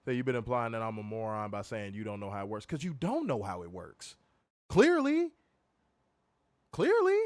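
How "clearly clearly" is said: high sarcastic clearly; mocking clearly. Real, not acted. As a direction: squeak it out mockingly. Mocking the other's word.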